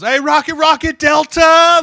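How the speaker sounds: very high, loud scream of the call. Retold or imitated. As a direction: scream him down, refusing to budge. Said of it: "they're just yelling at each other".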